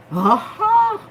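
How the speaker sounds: high, loud discovery cry; excited aha. Real, not acted. Reader flips a card and exclaims, excited. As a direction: pounce on the reveal.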